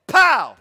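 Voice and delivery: sudden loud shout of pow; shouted exclamation. Acted. Preacher punctuating his sermon, loud shouted pow. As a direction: bang it out like a punch.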